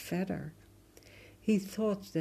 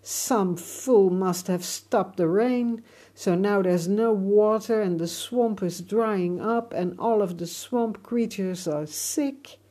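angry rant; 3 semitones higher, 7 dB louder than usual. Acted. Alligator rants about drought.

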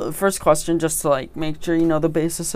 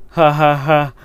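spoken ha ha laugh; 7 dB louder, slower than usual. Acted. Laughs at his own pun.

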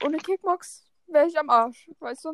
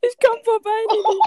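calling out; 7 semitones higher, 6 dB louder than usual. Real, not acted. Calling out to choking friend.

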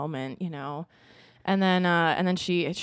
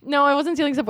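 higher, louder indignant denial; playful protest; 7 semitones higher, 5 dB louder, faster than usual. Real, not acted. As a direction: push back loudly at the teasing. Jokingly accused of stealing, protests.

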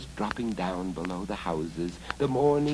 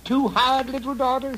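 high, loud goblin voice; high goblin coaxing; goblin man; 7 dB louder than usual. Acted. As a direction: squeak it out like an old goblin. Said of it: "said the old goblin man".

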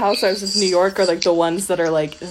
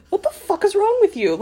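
a much higher, mock outraged chef yell; Gordon Ramsay rant; 12 semitones higher, faster than usual. Host mimics the TV chef berating a cook, in a hammed-up outraged voice. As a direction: fire it off like a furious TV chef. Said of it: "binge watching Gordon Ramsay".

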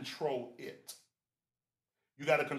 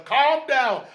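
high, loud preacher's cry; loud exhortation; 7 semitones higher, 10 dB louder than usual. Shown as a demonstration. Preacher urging brother and sister, loud exhortation. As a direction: belt it out to the congregation.

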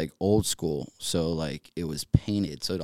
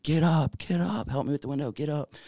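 high-pitched, urgent call for help; imitated yell, high but not full volume; retold yelling; 10 semitones higher than usual. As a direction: re-enact calling out to rouse people, pitch jumped up. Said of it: "I just start yelling".

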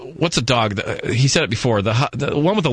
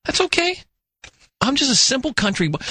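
fake bashful aw shucks; 11 semitones higher than usual. Mocked, plays hurt innocent.

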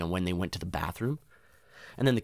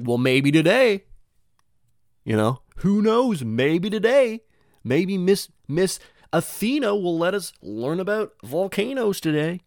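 higher, louder, slow dreamy wishing; hopeful kid self; 10 semitones higher, 7 dB louder, slower than usual. Retold or imitated. His grade three self hoping to learn volcanoes, high and dreamy. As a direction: wish it aloud like an eager kid. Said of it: "I was like".

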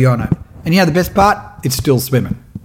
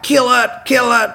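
higher, pitched-up heckling shout; mock crowd yell; 8 semitones higher than usual. Spectators on boats heckling the fisherman, mock yell. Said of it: "people yelling out".

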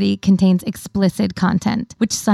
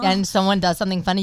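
ordinary talk, nothing marked

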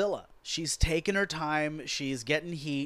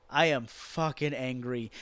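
usual speaking voice

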